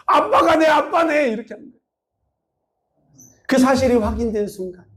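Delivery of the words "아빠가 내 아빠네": high, slow acted outcry; imitated shout. Daughter discovers dad is her real father, shouting. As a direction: stretch it out in amazed discovery. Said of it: "소리 지르면서 되게".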